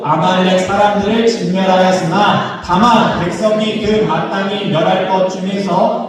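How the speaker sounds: slow, measured scripture recitation; scripture reading. A narrator's reading. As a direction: read the verse out slowly, as if leading a congregation. Scripture read aloud in unison. (성경 봉독하듯 느리고 또박또박)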